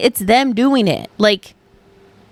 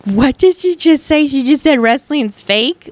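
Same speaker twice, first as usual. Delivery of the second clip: incredulous inner reaction; 4 semitones higher than usual. Retold or imitated. Retelling her shock at the coworker's remark. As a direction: replay the shock of hearing it. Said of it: "I was like".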